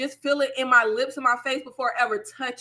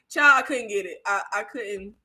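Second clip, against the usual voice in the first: normal talking voice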